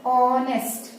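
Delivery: ऑनेस्ट said carefully with a silent h, opening on "aw"; teacher's careful model of ऑनेस्ट as "AW-nest"; pronunciation demo. Shown as a demonstration. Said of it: "उच्चारित करेंगे तो कैसे करेंगे?".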